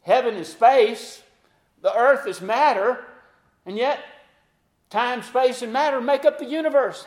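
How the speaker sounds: preacher building to a shout; fervent preaching. Acted. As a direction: drive the point home to the congregation.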